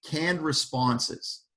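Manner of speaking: Canned responses, slow and quiet, canned stressed; slow emphasis. Shown as a demonstration. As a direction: drop it slowly like the answer. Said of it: "reciting verbatim".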